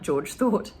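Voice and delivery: usual speaking voice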